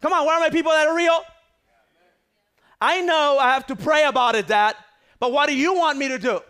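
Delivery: loud, high and fast, fired up; preaching shout. Acted. Preacher pleading with his dad before the congregation, loud and high. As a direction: whip the crowd up, then plead.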